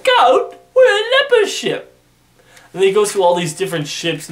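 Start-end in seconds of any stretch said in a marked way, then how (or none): 0.0-1.9 very high, loud, panicked cry; shocked shriek. Retold or imitated. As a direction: squeal it like startled sailors. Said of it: "And they're like".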